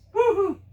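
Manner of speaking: high fake boo-hoo; mock crying. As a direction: tease her with a high fake sob. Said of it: "we're like".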